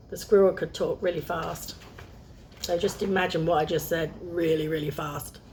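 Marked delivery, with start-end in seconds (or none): none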